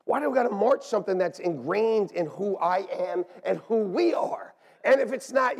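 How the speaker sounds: ordinary delivery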